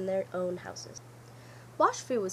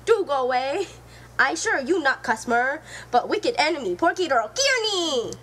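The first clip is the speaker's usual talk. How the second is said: louder, urgent pig voice; distressed pleading; 3 semitones higher, 7 dB louder than usual. Acted. Sing Shu pleads with the fox, loud and upset. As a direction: plead with rising panic.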